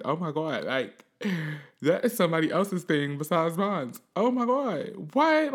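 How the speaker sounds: climbs to a high, loud squeal of disbelief; shocked young self. Retold or imitated. Younger self stunned by the video, rising to a high squeal. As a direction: gasp it, ending in a high "what?".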